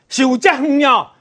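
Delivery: usual speaking voice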